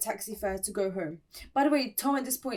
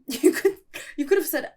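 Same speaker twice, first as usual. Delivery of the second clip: laughing; 5 semitones higher, 9 dB louder than usual. Real, not acted. Laughing at Tom's excuse.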